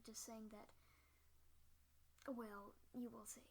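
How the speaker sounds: hushed, soft whisper of caution; whispered warning. Acted. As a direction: lean in and breathe a careful warning. Whispered recap before intro.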